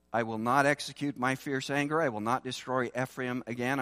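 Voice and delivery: fast, running recap of the verse; quick re-reading. Acted. Preacher quickly rereading God's vow to his congregation, brisk. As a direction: rattle it off as a reminder.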